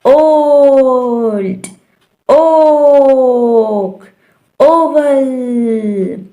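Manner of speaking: "oh" held very long in "ओल्ड"; long O examples. Shown as a demonstration. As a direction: stretch "ओक" slowly for a learner. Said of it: "long sound है".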